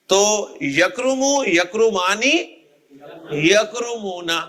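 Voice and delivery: half-speed teaching recitation of یکرمو، یکرمانی، یکرمونا; drawn-out model forms. Shown as a demonstration. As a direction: slowly chant out یکرمو، یکرمانی، یکرمونا with long endings. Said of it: "اونا کی آواز نکال کے".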